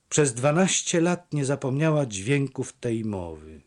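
ordinary delivery

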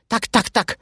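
high, squawky fretting drake; chattering duck. A narrator's reading. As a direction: jabber in alarm like a flustered duck. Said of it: "Majtał obolałą głową i dogadywał".

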